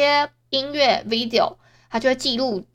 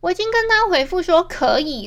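usual speaking voice

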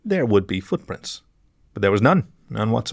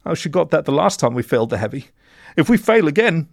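high, worried character voice; cornered accomplice fearing the boss; frightened mole in blue; 7 semitones higher than usual. A narrator's reading. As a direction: rise in pitch as the dread grows.

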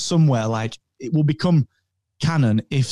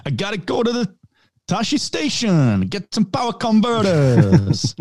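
Walken as whiny Luke; 7 semitones higher than usual. Retold or imitated. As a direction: drag out the words like Walken. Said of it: "characters that Christopher Walken could have played".

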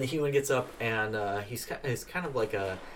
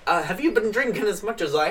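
much higher, louder chirpy little man's voice; tipsy little man; 8 semitones higher, 7 dB louder than usual. Acted. Thrown-out little drunk thanking the dwarf, high and loud. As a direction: play a cheerful drunk runt, pitched way up.

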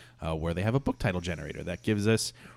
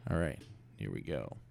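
usual speaking voice

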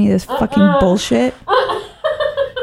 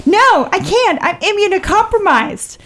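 high, indignant refusal; mock the prim excuse; raised, huffy protest; whiny stranger; 12 semitones higher than usual. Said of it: "She's like".

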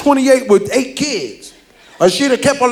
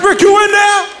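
loud call through the door; yelling to a friend behind the door; 10 semitones higher, 4 dB louder than usual. Retold or imitated. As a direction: shout it through a closed door.

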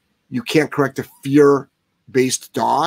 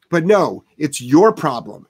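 usual speaking voice

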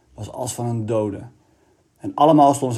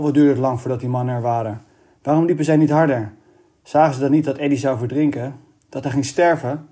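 plain reading voice

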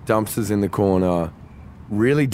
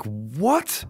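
higher, rising baffled question; retold disbelief; 4 semitones higher, 4 dB quieter than usual. Singer hears bouncer shrug off the bear, incredulous. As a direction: react incredulously, lifting sharply at the end.

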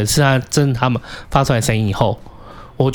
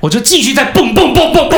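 loud, high, laughing through the knocks; gleeful mock pounding; laughing bang bang; 11 semitones higher, 5 dB louder than usual. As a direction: giggle as you bang away. Laughing while reenacting banging.

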